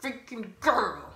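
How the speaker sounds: punchy mock outburst, GIRL stretched out; mad, disappointed. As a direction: complain it with a pouty emphasis on "girl". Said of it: "I was mad as fuck".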